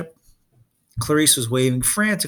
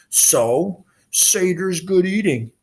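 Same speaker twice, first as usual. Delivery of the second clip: higher, slow monster voice; brutish Cyclops; 6 semitones higher, slower than usual. Acted. Polyphemus gloats to Percy in a slow monster voice. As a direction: relish the thought of eating.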